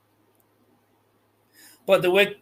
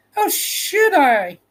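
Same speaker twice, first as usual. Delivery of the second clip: put-on bewildered girl; 9 semitones higher, 3 dB louder, slower than usual. Dorothy answering witch.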